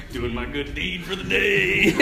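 slow, sing-song self-congratulation; self-mocking smugness. Priest mocking his pride in his good deed, sing-song smug. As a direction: croon it like a pleased do-gooder. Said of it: "I'm such an idiot".

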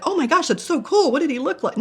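imagined staff gushing over her story, high and thrilled; mock excitement. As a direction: squeal like a fan hearing big news.

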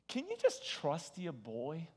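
soft, slow, knowing reassurance; smug reassurance. Shown as a demonstration. Preacher to wife and friends, soft knowing trust-me voice. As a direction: hush them like you've got it handled. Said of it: "I say it just like that".

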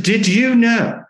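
slow indignant challenge; irate. Retold or imitated. Manager storms into the office, irate demand. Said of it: "very irate".

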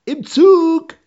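high yelling into phone; shouting at Mutti. Retold or imitated. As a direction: bellow over the line. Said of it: "so schreien".

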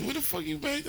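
high, incredulous mom voice; shrieking in disbelief like a mom; mom's reaction. Retold or imitated. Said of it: "My mom was like".

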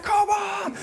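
put-on kids cheering the film. Brothers watching Braveheart, cheering excitedly. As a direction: shout it like a boy caught up in the battle. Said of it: "We're like".

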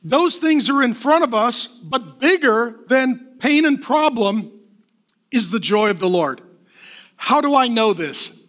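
ordinary talk, nothing marked

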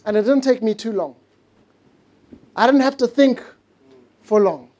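ordinary talk

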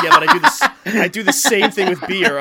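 high, loud laughing realization; excited realization. Retold or imitated. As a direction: blurt it out with a laugh. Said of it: "And then I'm like".

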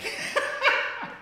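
high, squeaky laugh; breathless high laughter; wheezing laugh. Real, not acted. Laughing at own remark.

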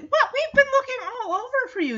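high-pitched mock exasperation; exasperated dog. Shadow finally finds the missing dog, high exasperation. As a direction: shoot the pitch right up in disbelief.

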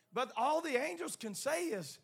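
ordinary talk

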